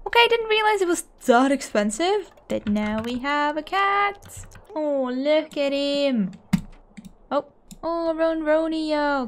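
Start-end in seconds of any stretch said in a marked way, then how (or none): none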